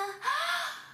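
high, sudden intake of breath; big gasp. Pretend. Teacher going pretend underwater, big audible gasp. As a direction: gulp air as if about to dive.

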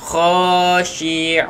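Khashi'una slow with a thick, throaty kha; teacher modelling a thick kha; heavy kha. Shown as a demonstration. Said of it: "Okey, kena kha".

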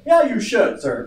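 loud, high mock snap back; imagined retort. Pastor voicing what he wanted to tell the caller, loud retort. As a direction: blurt the scolding comeback you held in. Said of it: "I wanted to say".

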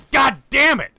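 sudden loud, high-pitched outburst; exasperated yell. Real, not acted. Player killed mid-sentence, exasperated yell. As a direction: explode in frustration.

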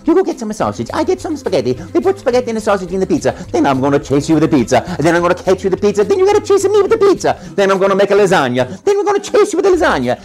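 put-on veel hoger, snel en luid Italiaans accent; Mario voice. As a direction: speel Mario opgewonden en razendsnel. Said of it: "I said in the voice that you hear today".

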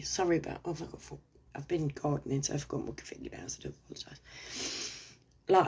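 ordinary talk

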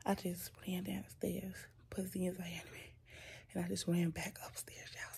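panting after running the stairs; out of breath. Real, not acted. Mom out of breath from the stairs, whispering to viewers. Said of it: "I'm sorry I'm breathing heavy".

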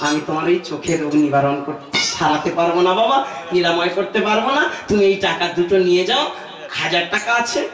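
put-on old doctor letting the patient down kindly. Doctor character consoles the blind man. (নরম গলায় বৃদ্ধ doctor)

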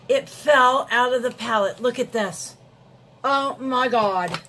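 loud, dismayed outcry; real shock. Real, not acted. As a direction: cry out in horrified disbelief. Discovers the highlighter broke loose.

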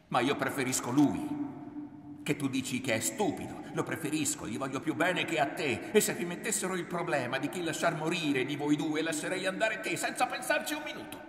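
loud, high, building to a cry; bitter angry outburst. A narrator's reading. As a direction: drive on relentlessly to wound her. Son's cruel outburst against mother.

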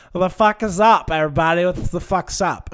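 loud, high, rowdy woman barging in; brash acted holler. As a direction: burst in hollering at the whole room. Bursting-in woman's acted greeting.

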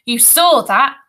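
high, loud accusation; boy's indignant protest; angry. A narrator's reading. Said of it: "Ruskin said angrily".

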